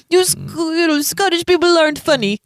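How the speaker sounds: a light, high mock French accent. Host imitating Roman's complaint in a French accent. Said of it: "with his little French accent saying".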